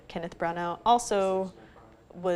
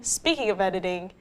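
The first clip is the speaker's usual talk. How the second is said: normal talking voice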